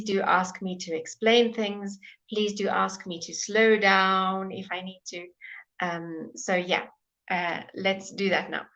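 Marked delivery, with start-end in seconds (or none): none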